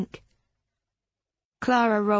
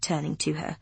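ordinary delivery, nothing marked

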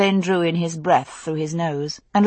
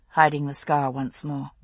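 plain reading voice

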